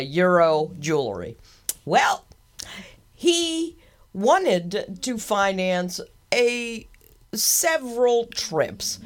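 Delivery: slow, drawn-out teasing reveal; suspenseful build-up. Acted. Host teasing the listeners before the punchline, slow suspense. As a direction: stretch it out to land the joke.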